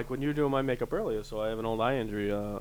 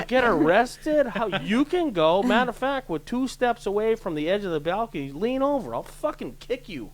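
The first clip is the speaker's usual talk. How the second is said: heated rant; 10 semitones higher, 6 dB louder than usual. Retold or imitated. Man refusing to join a balcony fight, heated rant. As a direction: tear into the drunk coworker.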